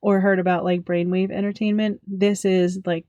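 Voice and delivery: ordinary delivery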